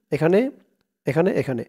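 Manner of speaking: usual speaking voice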